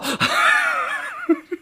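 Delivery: squealing laugh after a bite; startled high laugh. Real, not acted. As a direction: yelp and laugh at once. Gecko bites him suddenly.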